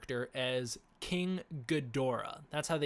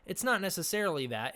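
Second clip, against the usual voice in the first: ordinary delivery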